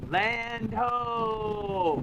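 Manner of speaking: long high sung-out land call; shouting from crow's nest. Real, not acted. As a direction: cry it out like a lookout. Sighting land after six days.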